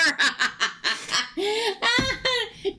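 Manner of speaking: long, very high cackling laugh; helpless laughter. Real, not acted. Reader tickled by a Gemini move, helpless high laughter. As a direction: crack up at your own remark. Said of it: "I can't quit laughing".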